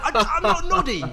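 very high, loud mock indignation; outraged protest. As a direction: replay a pupil's outburst, voice shooting up. Retells his outraged protest to teacher.

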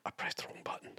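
barely audible whisper. Real, not acted. Host owning up to wrong button, whispering. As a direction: whisper it as a furtive confession. Slips up before show starts.

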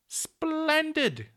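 very high and loud; delighted cry. A narrator's reading. Children to the peddler about his plan, delighted. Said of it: "cried the children in delight".

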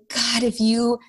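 ordinary talk, nothing marked

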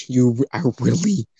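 usual speaking voice

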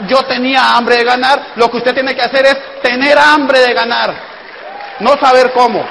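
loud, high, driving sales-talk; preaching loudly. Real, not acted. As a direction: hammer the point home to the crowd. Motivational speaker preaching to crowd.

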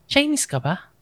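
mild mock sarcasm; lightly imitating a taunting question; sarcastic. Retelling a cousin's jab during an argument, mildly sarcastic. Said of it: "parang sarcastic na parang".

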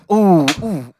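pained cry. Acted. Pained cries during foot squeeze.

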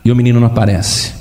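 ordinary delivery

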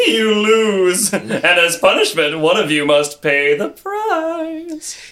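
slow menacing verdict; triumphant monster passing sentence; gloating creature. Acted. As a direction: pronounce doom, stretching the final words.

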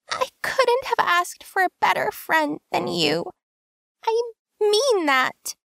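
mean every word tenderly; sincere. A narrator's reading. Tansu telling Kim he is her best friend, sincere.

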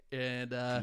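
normal talking voice

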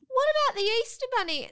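put-on high, loud, fast little-girl plea; child's plea. Tearful daughter to mum, high fast plea.

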